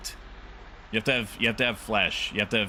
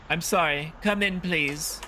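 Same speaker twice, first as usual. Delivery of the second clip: higher-pitched apologetic granny; old lady; 7 semitones higher than usual. Acted. Old lady lets the debt collector in, high polite granny voice.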